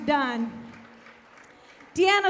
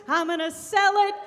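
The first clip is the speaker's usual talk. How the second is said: usual speaking voice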